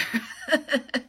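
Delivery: short falling laugh; quick amused laugh. Real, not acted. Laughs at own joke.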